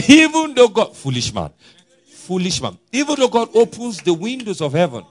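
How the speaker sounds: high, loud scoffing voice; mocking doubter. Preacher mimicking the doubter's scoffing, high-pitched. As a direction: sneer at the idea of heaven's windows.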